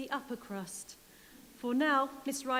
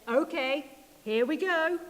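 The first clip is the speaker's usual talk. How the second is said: louder, eager wolf getting ready; lightly voiced wolf rolling up sleeves; 3 semitones higher, 5 dB louder than usual. Wolf about to blow, louder and eager. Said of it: "The wolf said".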